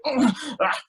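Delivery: mock grunts of strain; effortful lifting grunts. Being the one lifting things at weddings, mock straining grunts. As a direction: imitate heaving something heavy.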